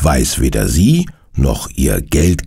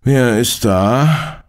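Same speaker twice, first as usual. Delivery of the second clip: slow, higher-pitched sleepy voice; 8 semitones higher, much slower than usual. A narrator's reading. Resident answering the doorbell at night, sleepy. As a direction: drag it out as if just woken. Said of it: "fragt eine verschlafene Stimme".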